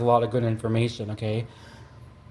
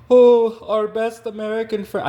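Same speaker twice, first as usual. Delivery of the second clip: high, loud mock German accent; a failed stab at a German accent; bad German accent; 8 semitones higher, 5 dB louder than usual. Mocking the neighbors in a bad German accent. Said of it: "I can't do a German accent".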